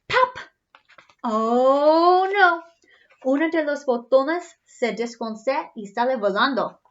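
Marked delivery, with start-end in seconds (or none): 0.1-0.4 high, loud popping sound; high loud pop. Acted. Button pops off. 1.2-2.6 mock dismay. Reacting to lost button.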